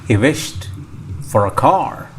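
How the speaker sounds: ordinary delivery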